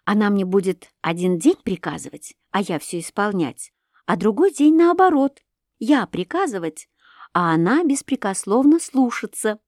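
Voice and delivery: usual speaking voice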